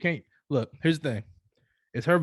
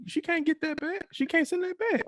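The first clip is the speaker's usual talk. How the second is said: high mock voice. Mocking the breakup, voice climbs.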